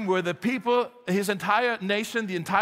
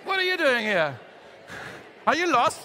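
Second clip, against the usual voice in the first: mock-rude challenge, pitched up; 4 semitones higher than usual. Shown as a demonstration. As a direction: playfully confront an unwelcome newcomer. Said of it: "just very rudely just say".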